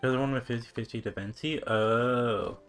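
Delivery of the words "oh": ordinary talk, nothing marked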